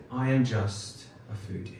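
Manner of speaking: ordinary talk, nothing marked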